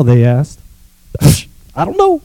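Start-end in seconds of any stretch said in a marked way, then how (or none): 1.7-2.2 high, soft and small; a touch of a small helpless voice; high soft reply. Acted. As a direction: answer in a little high voice. Healed man answers.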